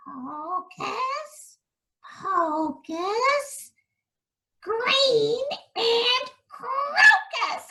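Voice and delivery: high, slow chanted spell; witch spell. Acted. Witch casting a spell on the prince, high and gleeful.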